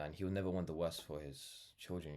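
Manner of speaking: ordinary delivery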